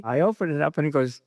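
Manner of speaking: ordinary talk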